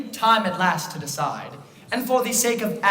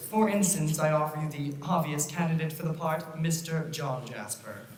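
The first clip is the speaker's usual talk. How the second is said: hushed ominous; 2 semitones lower, 6 dB quieter than usual. Acted. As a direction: drop to a sinister hush. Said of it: "do this until we get it right".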